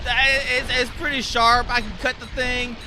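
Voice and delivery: put-on Southern US accent, lifted and loud. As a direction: play the Southern caller on the phone. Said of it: "if I can even do a southern voice".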